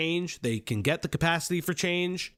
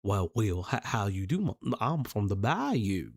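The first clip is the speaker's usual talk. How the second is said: mock southern accent; 11 semitones lower than usual. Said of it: "southern accent".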